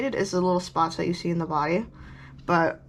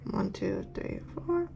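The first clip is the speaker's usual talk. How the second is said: counting under breath; 9 dB quieter, slower than usual. Real, not acted.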